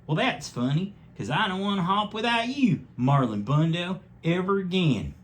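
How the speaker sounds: high, delighted bunny voice; Wesley answering Marlon's proposal, high and happy. A narrator's reading. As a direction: go way up, smitten and sweet. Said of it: "And Wesley said".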